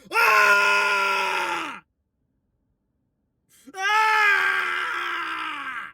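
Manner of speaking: high, cracking victory yell; triumphant hoarse yell. Real, not acted. Fan celebrating the win, hoarse yell. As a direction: let out a raw victory cry.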